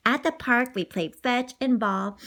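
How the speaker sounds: ordinary delivery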